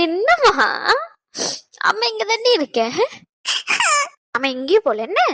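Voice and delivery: crying while comforting; weeping mother soothing her son; tearful. Acted. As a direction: choke back tears and reassure. Mother crying over upset child.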